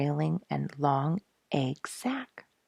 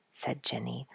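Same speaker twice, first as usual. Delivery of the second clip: ordinary delivery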